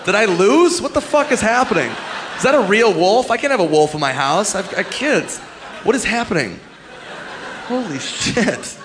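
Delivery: mock panic. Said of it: "It's like".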